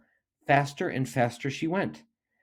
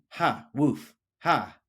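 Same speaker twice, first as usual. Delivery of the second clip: mocking ha with a woof; dog jeering at the tortoise; barking laugh; 2 semitones higher, much slower than usual. A narrator's reading. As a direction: bark and laugh at her. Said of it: "barked the dog".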